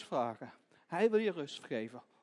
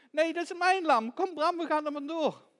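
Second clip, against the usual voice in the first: put-on high, loud defiant child; defiant protest; 9 semitones higher, 7 dB louder than usual. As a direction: refuse loudly and urge the lamb away. Child defies thief, urges escape.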